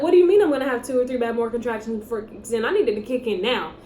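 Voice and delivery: rattled off quickly; fast indignant retelling. Retold or imitated. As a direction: hurry through the complaint, exasperated. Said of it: "I was like".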